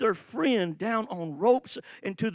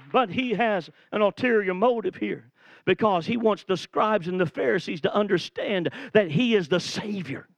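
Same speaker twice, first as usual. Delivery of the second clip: ordinary talk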